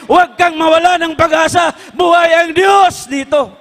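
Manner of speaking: fervent pleading cry; urgent shout. Retold or imitated. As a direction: rouse her from despair with conviction. Man urging his dying wife.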